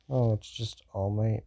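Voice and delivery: unimpressed, understated reaction; cool indifference. Acted. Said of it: "Kinda like Todoroki. Not that way".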